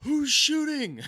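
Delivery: high, loud hollered question; retold yell. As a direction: holler it out across the woods. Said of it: "is you yelling".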